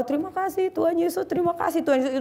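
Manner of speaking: a father's overjoyed thanks; grateful prayer. Retold or imitated.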